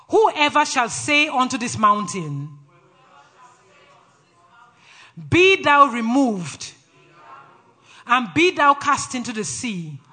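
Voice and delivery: slow, loud, emphatic call-out; authoritative scripture declaration. Shown as a demonstration. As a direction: declare it line by line with power. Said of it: "Say it with authority".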